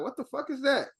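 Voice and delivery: jumpy startled shout, up high. Retold or imitated. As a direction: react as if the laundry cart moved. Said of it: "They be like".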